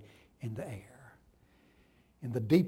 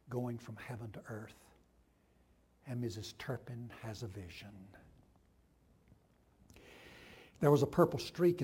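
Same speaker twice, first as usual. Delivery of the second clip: hushed vision narration; 5 semitones lower, 6 dB quieter than usual. A narrator's reading. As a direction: hush them into the vision. Quiet reverent vision narration.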